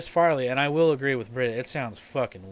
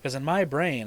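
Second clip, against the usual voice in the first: ordinary talk, nothing marked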